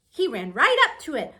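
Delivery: ordinary delivery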